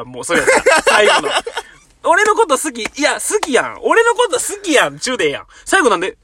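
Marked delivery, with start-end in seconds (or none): 2.0-5.0 high, laughing parody of a brainwashing pitch; mocking pushy suitor. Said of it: "まくし立てる時あるやん".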